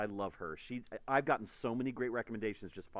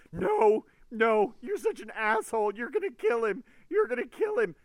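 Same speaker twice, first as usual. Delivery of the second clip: high, loud furious yell; mad friend yelling; 12 dB louder than usual. Retold or imitated. Friend on the phone screaming about the book. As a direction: shriek it like an outraged reader. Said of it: "He was so mad".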